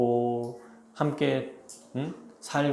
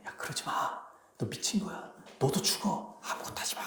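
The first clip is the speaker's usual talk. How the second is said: hushed urgent whisper to a friend; frightened whisper; 4 semitones higher, 12 dB quieter than usual. Acted. Villagers whispering to the disguised king, frightened whisper. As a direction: whisper the warning so the noble can't hear. Villagers warn the rash one.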